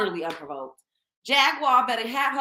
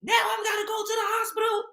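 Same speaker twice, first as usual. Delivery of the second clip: high, yelled retort; hammed-up yelling passenger; mock shout; 9 semitones higher, faster than usual. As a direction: shout it back at him, indignant. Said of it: "She said".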